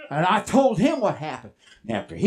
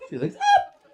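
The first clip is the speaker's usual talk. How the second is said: rising looking up; 3 semitones lower than usual. Acted. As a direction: lift sharply on up. Horse looking up, acted by pitch.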